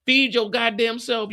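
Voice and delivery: normal talking voice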